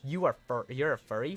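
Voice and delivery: normal talking voice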